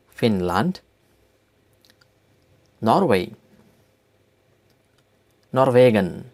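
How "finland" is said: "Finland" in German, as FIN-lahnt, rising; German-style "Finland", lifted at the end; German pronunciation. Shown as a demonstration. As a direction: say "Finland" with a full second vowel. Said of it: "names of countries with German accent".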